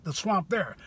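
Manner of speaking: ordinary delivery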